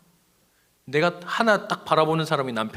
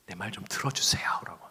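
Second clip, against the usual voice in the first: whispered plea; 3 semitones lower, 5 dB quieter than usual. Acted. Leah's plea voiced in a story.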